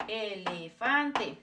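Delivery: "elefante" slowly, with every vowel held; sounding out vowels. Shown as a demonstration. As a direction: draw out each syllable of "elefante". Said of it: "Always sound the same".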